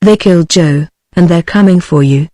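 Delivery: plain reading voice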